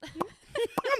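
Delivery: usual speaking voice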